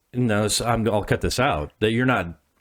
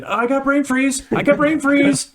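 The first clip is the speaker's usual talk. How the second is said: put-on whiny kid brain freeze; 6 dB louder than usual. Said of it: "do you get the fucking".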